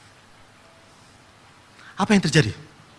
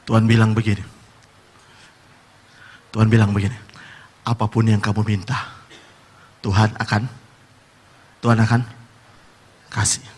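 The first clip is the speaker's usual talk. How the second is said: solemn low preaching; 10 semitones lower than usual. Acted. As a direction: lower and lay the promise down gently. Slow solemn quoting of God.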